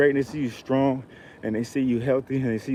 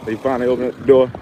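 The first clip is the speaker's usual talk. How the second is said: normal talking voice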